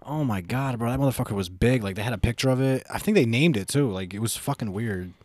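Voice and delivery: ordinary delivery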